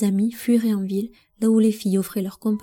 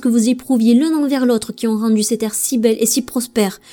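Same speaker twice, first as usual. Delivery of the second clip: loud, raised defiance; indignant protest; 3 semitones higher, 6 dB louder than usual. Acted. Prince defying his mother, loud and indignant. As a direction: stand up to her, voice lifted. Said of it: "s'insurgea-t-il".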